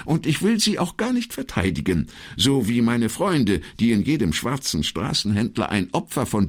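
high-pitched, urgent secretary; insistent. Acted. Secretary heads off the commissario's objection, insistent. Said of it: "und fuhr eindringlich fort".

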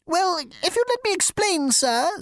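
high, fussy insect voice; insect receptionist. Acted. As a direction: squeak it like a prissy bug.

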